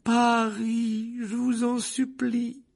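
voix aiguë et lente de mère qui supplie; implorer, désespérée; mère suppliante. Acted. (mère qui implore, aiguë)

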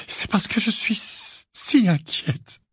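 high, slow, halting sobbed words; tearful. A narrator's reading. Missus Wesley explains her worry, tearful. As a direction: break off and push on through tears. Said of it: "des larmes débordant à nouveau".

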